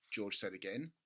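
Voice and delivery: usual speaking voice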